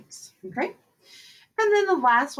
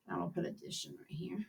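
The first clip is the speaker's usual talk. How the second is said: muttered aside; 6 semitones lower, 16 dB quieter, much faster than usual. Real, not acted. Muttered aside while writing.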